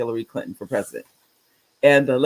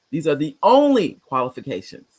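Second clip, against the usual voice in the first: normal talking voice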